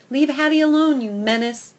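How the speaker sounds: loud, high warning bark; barking at him. Acted. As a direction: bark it out at the squirrel. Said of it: "I bark".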